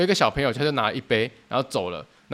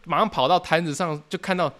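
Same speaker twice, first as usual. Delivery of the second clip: ordinary talk, nothing marked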